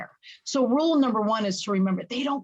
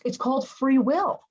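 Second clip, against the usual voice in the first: ordinary talk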